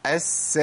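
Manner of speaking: أس with a held hissing S; a stretched S in أس; doubled sin. Shown as a demonstration. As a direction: hold the S of أس a moment. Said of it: "تشديد السين".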